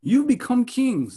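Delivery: mock the boasters with fake awe; sarcastic. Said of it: "it's sarcastic".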